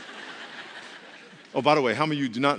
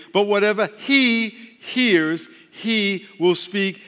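slow emphatic scripture reading; 5 semitones higher, 6 dB louder, much slower than usual. Acted.

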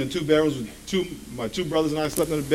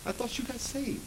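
quiet; 13 dB quieter than usual. Pretend. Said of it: "And God said".

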